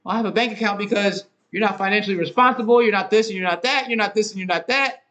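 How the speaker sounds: imitating the girlfriend snapping back during the money argument; girlfriend's retort. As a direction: throw her accusation back high-pitched. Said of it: "this is gonna lead into an argument".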